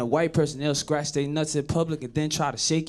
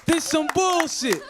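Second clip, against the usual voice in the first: higher, slow fed-up ending; a slow, pitched-up disgusted close; 8 semitones higher than usual. Retold or imitated. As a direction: drag it out, exasperated. Story ending in character.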